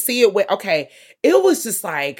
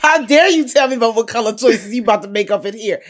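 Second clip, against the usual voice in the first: louder, laughing through a scoffing retort; laughing indignant quote; 5 semitones higher, 5 dB louder than usual. Retold or imitated. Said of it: "He's just like".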